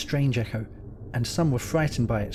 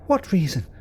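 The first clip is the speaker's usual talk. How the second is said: high, loud frightened question; 6 semitones higher, 4 dB louder than usual. A narrator's reading. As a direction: blurt it out in fear. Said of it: "asked Evelyn in a frightened voice".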